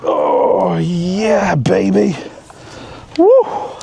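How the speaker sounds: high whooping cheer; celebrating. Real, not acted. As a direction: yell in triumph. Fish landed, celebrating.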